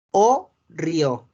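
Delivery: "o río" slowly, as two separate parts; French word demonstration. Shown as a demonstration. Teacher demonstrating for students, "o río" slow with silent T. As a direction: say "o río" ending on an open O, no T. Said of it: "hacen una O".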